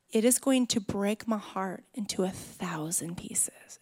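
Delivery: put-on breathy whisper to the child. Mother whispering a plea to her lying son, soft. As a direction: hush it, heartfelt and soft.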